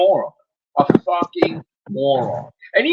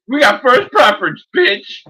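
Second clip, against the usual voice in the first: louder, higher gloating jab; taunting brag, raised voice; 8 semitones higher, 6 dB louder than usual. Real, not acted. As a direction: rub it in his face. Gloating at rival.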